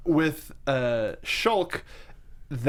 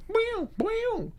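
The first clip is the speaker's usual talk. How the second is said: high cartoon boing; 4 dB quieter than usual. Sound imitation.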